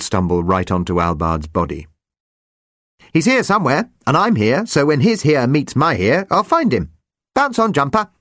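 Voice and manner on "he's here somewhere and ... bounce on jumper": high, bouncy little chirp; shrill chirpy voice. Acted. Jumper talks to himself while hopping, shrill and chirpy. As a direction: chirp it like a cheerful sprite hopping along.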